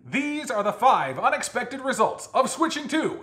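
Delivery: big, booming, high-pitched announcer delivery; hammed-up game-show style announcer. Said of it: "Hit him with the announcer voice".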